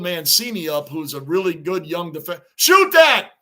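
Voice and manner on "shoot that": urgent yell at the screen; sudden shout. Real, not acted. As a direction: blurt it out loud.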